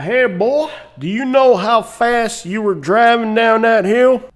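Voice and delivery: high, loud mock Southern lawman; Southern cop. Southern cop pulling a driver over, hammed-up drawling officer. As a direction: accost them like a Southern cop. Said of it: "pulled over by a racist Southern cop".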